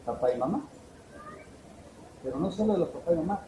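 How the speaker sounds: ordinary talk, nothing marked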